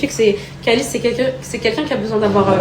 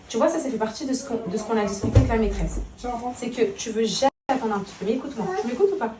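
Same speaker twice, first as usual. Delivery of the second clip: calm him so he listens; gentle mother talk; 10 dB quieter than usual. Real, not acted. Mother talks softly to her son about school.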